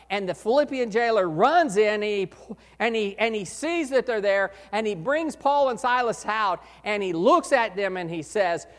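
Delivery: ordinary talk, nothing marked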